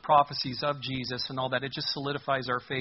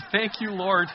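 laughing thanks; 5 semitones higher, slower than usual. Real, not acted. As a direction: chuckle through thank you, Lord. Laughing thanks mid-sermon.

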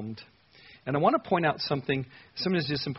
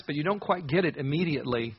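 normal talking voice

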